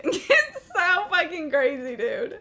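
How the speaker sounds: laughing. Real, not acted. Laughing at own story.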